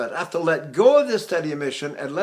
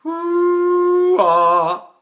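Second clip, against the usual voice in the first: slow falsetto to chest; 12 semitones higher, 7 dB louder than usual. Shown as a demonstration. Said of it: "I mean slowly".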